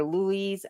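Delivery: Louise ending in a Z sound, loo-EEZ; pronunciation option. Shown as a demonstration. Unsure streamer trying a viewer's name, Louise said as loo-EEZ. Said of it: "how to pronounce your name".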